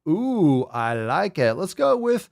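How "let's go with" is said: game show announcer. Acted. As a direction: call her up to the main stage. Announcing contestant like a host.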